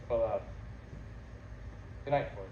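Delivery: low, soft, hesitant; subdued and defeated; resigned parting. Acted. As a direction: withdraw with a halting good night. Resigned parting after quarrel.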